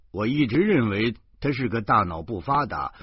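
ordinary delivery, nothing marked

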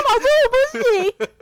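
high, loud, pleading wail; near tears. Retold or imitated. Student begging her teacher, high, loud and near tears. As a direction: beg the teacher on the verge of tears. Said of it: "你知道那时候我都快哭了".

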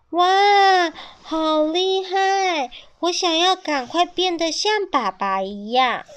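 high, excited admiration; baby dinosaur marvels at father's skill; awed child. A narrator's reading. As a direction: gasp in wonder at father.

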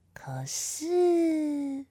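secret whisper. Acted. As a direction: lean in and whisper. Whispered aside.

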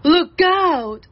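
loud deer calling out an alarm; cried warning. Acted. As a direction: shout a sharp warning to friends. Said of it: "Cried Mister Deer".

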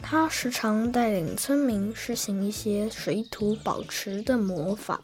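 gentle storybook narration; story narration. Acted. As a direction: read it like a bedtime tale.

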